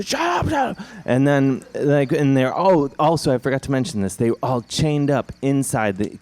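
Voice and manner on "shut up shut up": high, rapid squabbling retort; hammed-up high-pitched squabble; mock bickering. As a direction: cut them off in a high pitch. Acted quarrel among the captives.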